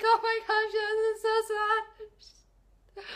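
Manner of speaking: high, slow sing-song sob; mock crying to mom, slow and sung; mock wailing. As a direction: ham up the teary wail. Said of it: "And I was just like".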